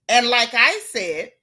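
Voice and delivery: normal talking voice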